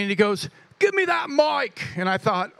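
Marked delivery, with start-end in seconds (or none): 0.8-1.8 high-pitched mock British accent; imitated British accent, pitched way up; Caleb's accent. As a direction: demand it in a put-on British accent.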